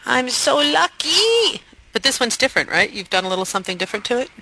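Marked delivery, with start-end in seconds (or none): 0.0-1.6 slow, higher mock delight; sarcastic. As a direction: drawl it with mock glee. Mock gratitude for duplicate file.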